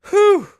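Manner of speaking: high loud whew of effort; strained exhale settling down; relieved whew. Real, not acted. Settling into the chair.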